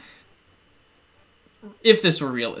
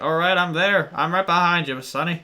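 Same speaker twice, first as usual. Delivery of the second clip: put-on old man; 4 semitones higher than usual. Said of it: "he's just like".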